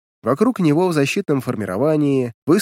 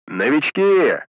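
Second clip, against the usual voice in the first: barking call; 2 semitones higher, much slower than usual. Acted. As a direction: bark it over the radio. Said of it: "рявкнул Джолтак в рацию". (рявкнул в рацию)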